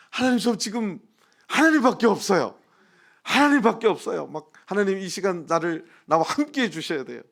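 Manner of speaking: high, loud pleading prayer; fervent prayer. Retold or imitated. Pastor re-enacting his prayer for God's help, fervent prayer. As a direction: cry out desperately to God, easing toward the end. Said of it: "간절히 막 기도했어요".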